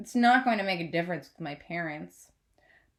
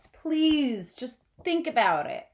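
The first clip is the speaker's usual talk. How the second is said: through gritted teeth; 4 semitones higher, slower than usual. A narrator's reading. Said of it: "through my gritted teeth as I calmly".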